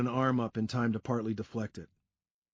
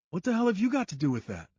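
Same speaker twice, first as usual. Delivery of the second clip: put-on higher angry-alarmed young guy; alarmed Sean; 8 semitones higher, faster than usual. A narrator's reading. Sean demanding why the narrator cares about Claire, higher. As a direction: challenge him sharply.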